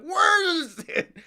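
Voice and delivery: high, drawn-out mock wail; imitated screaming. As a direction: mimic a petty kid screaming. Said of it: "he's, like, screaming at his grandparents".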